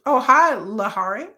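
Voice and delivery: normal talking voice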